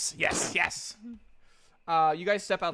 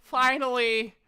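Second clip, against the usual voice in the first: higher-pitched shopkeeper voice, a weary seller listing wares; tired half-elf merchant; 6 semitones higher than usual. Acted. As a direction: play the sleepless half-elf eager to sell.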